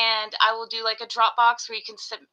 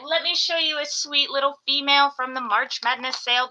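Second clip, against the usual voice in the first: ordinary talk, nothing marked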